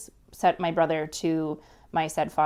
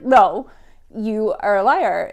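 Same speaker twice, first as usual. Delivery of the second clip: louder, firm accusation; retold confronting retort, louder; accusing; 3 semitones higher, 8 dB louder, slower than usual. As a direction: call her out flatly. Confronting mother on the phone.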